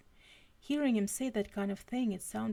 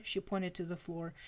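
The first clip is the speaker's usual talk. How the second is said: ordinary delivery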